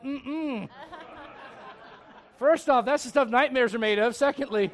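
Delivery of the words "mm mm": refusing hum. Pretend. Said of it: "I'd be like".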